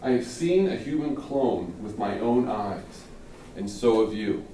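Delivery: slow, weighty delivery of a hook; slow, measured opening hook; dramatic opening line. Shown as a demonstration. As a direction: deliver it like a speech opener, slowly. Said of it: "This is how you start it off".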